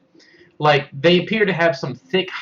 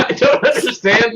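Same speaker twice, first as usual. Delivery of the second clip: laughing; 9 semitones higher, 5 dB louder than usual. Real, not acted. Laughing confusion.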